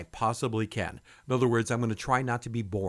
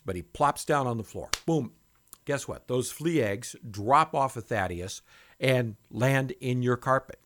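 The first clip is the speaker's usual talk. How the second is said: ordinary delivery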